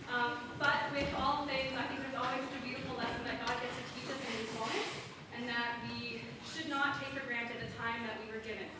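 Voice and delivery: ordinary talk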